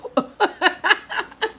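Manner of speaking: real laughter; laughing. Real, not acted. Laugh alone on line.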